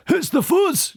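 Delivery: high yelling goon; yelled. A narrator's reading. Crook Fingers yells to the gang that police are coming. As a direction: yell the warning high. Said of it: "yelled Fingers".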